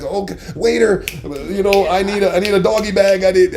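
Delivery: high, loud, hurried panicking voice; panicked wife. Retold or imitated. Wife panicking, asking the waiter for a doggy bag. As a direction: rush the waiter in a fluster.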